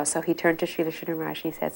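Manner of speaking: usual speaking voice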